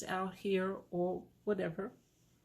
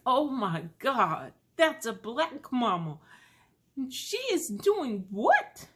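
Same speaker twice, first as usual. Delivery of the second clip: mock-shocked onlookers; 6 semitones higher, 8 dB louder than usual. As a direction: play the scandalized stranger, jaw dropped. Passers-by judging her.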